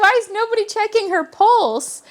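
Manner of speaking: exasperated disbelief; incredulous. Retold or imitated. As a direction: ask it like you cannot believe it. Incredulous reaction to the story.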